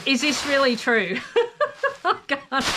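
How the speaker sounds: giggling, high and delighted; laughing in disbelief. Real, not acted. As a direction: laugh it out, can't believe it.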